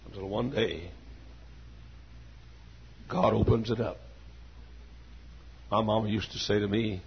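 ordinary talk, nothing marked

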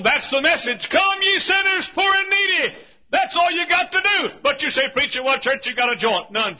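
high, loud revival exhortation; full-force preaching. Real, not acted. Preacher pressing sinners to come, shouted high. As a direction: proclaim it hard to the whole congregation.

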